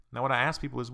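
ordinary talk, nothing marked

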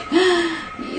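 sudden high gasp; sharp gasp. Retold or imitated. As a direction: gasp in shock. Demonstrating the shocked reaction.

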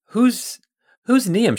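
high, startled question; sharp surprise. Acted. Scientist hears the stranger's name, startled question. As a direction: snap the name back in alarm.

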